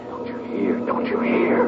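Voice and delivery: hushed whisper. Acted. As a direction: whisper it in awe. Awed realisation, hushed repetition.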